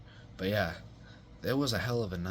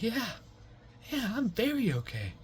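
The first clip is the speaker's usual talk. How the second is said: mock stoned reassurance. High stoned reassurance to friend's mom.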